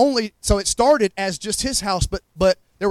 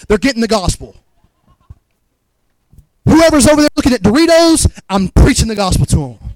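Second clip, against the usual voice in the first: loud, higher-pitched, urgent preacher; fervent preaching; 4 semitones higher, 5 dB louder than usual. Real, not acted. Preacher urging the church to evangelize, fervent preaching. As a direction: drive it home with raised voice.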